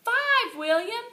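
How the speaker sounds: high, loud cheer; whole class yelling the count; shouted cheer. A narrator's reading. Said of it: "we shouted".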